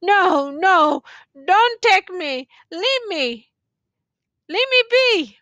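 a hint of a dull, high refusal; doomed soldier refuses the youth; dulled protest. A narrator's reading. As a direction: refuse again, half distant. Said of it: "protested in a dulled way".

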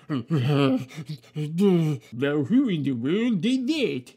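low, gruff night watchman demanding an answer. Acted. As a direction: play a suspicious guard barking a question. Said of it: "he exclaimed".

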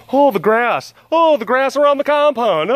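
high, loud delighted cry; high excited coo; excited squeal. Real, not acted. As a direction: gush over the grass like a thrilled fan.

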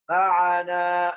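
very slow معنا with the final alif held as a long "aa"; long, sustained "aa" ending معنا; stretched long vowel. Shown as a demonstration. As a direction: draw out the closing "naa" of معنا. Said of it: "مد بالألف".

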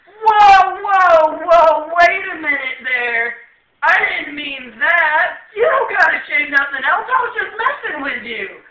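high, very loud panicked protest; alarmed yelling. Retold or imitated. Sergeant stopping him pulling his pants down, alarmed yelling. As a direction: yell to halt him, high and drawn out.

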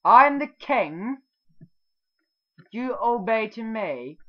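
slow, loud, high mock royal command; king voice. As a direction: lord it over them like a pompous king. Plays king demanding obedience.

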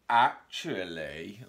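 slow, loud drawn-out actually; knowing mole. Acted. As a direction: stretch it like you know better. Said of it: "But Mole said".

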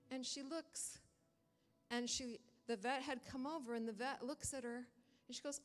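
ordinary delivery

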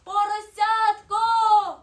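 high, very loud, long-drawn call; stretched, ringing shout. A narrator's reading. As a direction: holler it high as the snowflake. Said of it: "гукнула вона".

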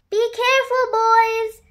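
high call from the window; warning shout. A narrator's reading. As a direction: call a caution across the garden. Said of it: "shouted Nanny Hen from the window".

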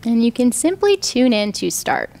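calm, even meditation voice with long pauses; guided meditation. Real, not acted. Yoga teacher guiding students lying down into rest, meditative.

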